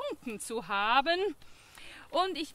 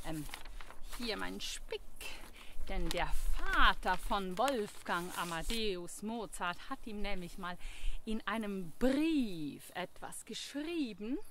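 ordinary talk

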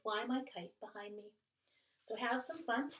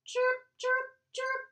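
much higher squeaky chick voice; squeaking chicks; 11 semitones higher, 7 dB louder, slower than usual. Acted. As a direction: peep like baby birds. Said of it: "squeaked the chicks".

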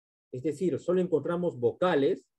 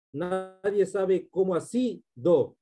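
slow line with "así, do" split, SÍ held high; stress on last accented vowel; 4 semitones higher, slower than usual. Shown as a demonstration. Teacher showing the accented vowel in a verse, stretched. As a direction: lift and hold the sí of "así", then drop on do. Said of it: "a partir de la última vocal acentuada".

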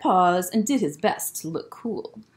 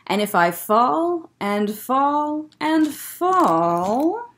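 slow falling; 4 semitones higher, much slower than usual. Acted.

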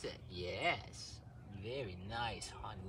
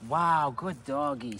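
excited praise; 3 semitones lower, 8 dB louder than usual. Real, not acted. Loud praise.

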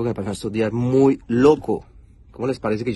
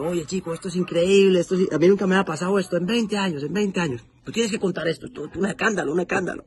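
put-on much higher, playful Cuban cop accent; 8 semitones higher than usual. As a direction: imitate the Cuban officer's Cuban accent. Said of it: "porque él es cubano". (acento cubano del policía, agudo y divertido)

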